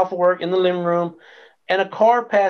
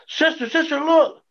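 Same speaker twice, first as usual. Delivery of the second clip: retold excited cry, pitched high; excited calling out; 11 semitones higher than usual. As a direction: call your sister over to look, high-pitched. Excitedly showing his sister the change.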